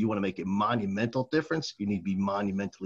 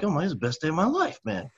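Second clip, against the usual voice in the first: high, fast, laughing elation; elated; 9 semitones higher, 4 dB louder, faster than usual. Retold or imitated. Seventh grader back in class after the escape, elated. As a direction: retell it as a giddy kid.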